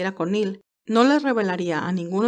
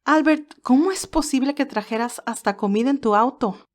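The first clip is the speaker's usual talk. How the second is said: high, loud, amazed outburst; surprised Candy; 5 semitones higher, 3 dB louder than usual. Acted. Candy to Albert, astonished at the food, surprised and higher. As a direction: gasp it out in delighted surprise.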